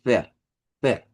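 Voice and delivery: "ver" with the R sounded; German "ver" with R. Shown as a demonstration. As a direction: say "ver" with a rolled R. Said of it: "como que fuera una R". ("ver" pronunciado con R)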